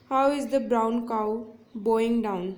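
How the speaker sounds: slow example read. Shown as a demonstration. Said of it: "यहाँ पे example देखते हैं".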